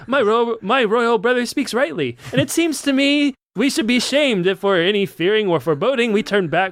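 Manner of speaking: high, grand kingly reading; pompous royal voice. Acted. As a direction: read it like a pompous king. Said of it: "That's good stuff".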